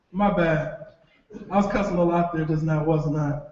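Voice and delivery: soft, low comedown after yelling; sheepish apology. Acted. Father catching himself after cursing before his child, sheepish low voice. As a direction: drop low and quiet, owning up.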